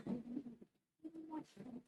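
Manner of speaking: very soft and very slow, trailing off; a soft, slow, almost whispered plea; soft drawn-out plea. Real, not acted. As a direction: let it fade out slowly and softly. Pleading for more time.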